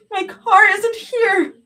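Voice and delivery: high, loud, slow sob; crying helplessness; sobbing. A narrator's reading. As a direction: sob the words out. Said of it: "Zoe sobbed".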